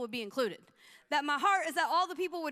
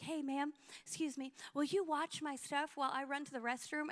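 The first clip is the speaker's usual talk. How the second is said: lightly voiced stranger, softer; casual favour request; 7 dB quieter than usual. Lady to stranger at the coffee shop, quiet polite request. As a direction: quietly ask a small favour.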